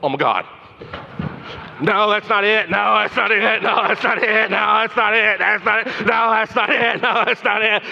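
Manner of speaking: hammed-up flustered search; mock panic. Brain's gerbils hunting the name, in mock panic.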